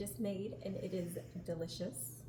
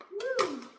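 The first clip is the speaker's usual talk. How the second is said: startled whoop; 13 semitones higher, 7 dB louder than usual. Real, not acted. Onion fumes sting her eyes.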